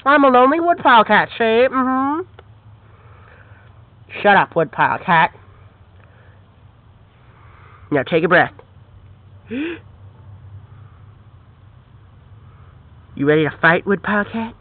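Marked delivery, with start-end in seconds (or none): 4.2-14.5 very low growly villain voice; menacing dog villain. Acted. Dog villain plotting against the cat, very low menacing voice. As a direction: drop way down like a brute dog.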